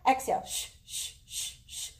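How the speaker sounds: breathy shh on each exhale sip; short shh puffs of breath; shushing exhale. Shown as a demonstration. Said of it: "Small sips of breath".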